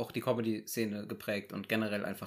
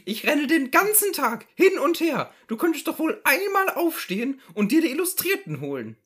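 higher, reproachful housewife voice; reproachful wife; 8 dB louder than usual. Acted. Wife scolding idle husband, reproachful. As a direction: lay on the guilt. S0 plays wife in Loriot sketch.

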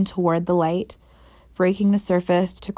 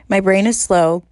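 ordinary delivery, nothing marked

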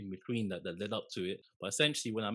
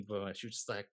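ordinary talk, nothing marked

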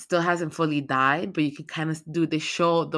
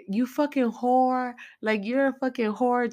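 higher, slow voiced old woman cursing; spiteful old woman; 7 semitones higher than usual. Broken-hipped Pearl cursing Maxine, high spiteful voice. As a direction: spit it out like a bitter old lady.

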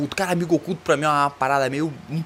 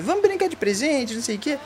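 high, mocking voice of a cheerful coworker; mock sing-song invite; 9 semitones higher than usual. Mocking office colleagues inviting everyone to play, high sing-song voice. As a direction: sing it out higher like an annoying party planner. Mocking the year-end crowd.

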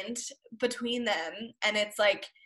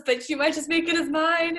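Anakin impression; 6 semitones higher, 3 dB louder than usual. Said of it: "but then he goes".

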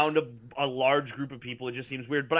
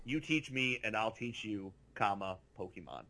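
mock quoted meme; 5 semitones lower, 10 dB quieter than usual. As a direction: read the meme out like a solemn caption. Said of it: "in quotes".

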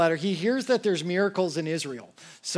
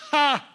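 short scoffing laugh; 9 semitones higher, 5 dB louder than usual. Real, not acted.

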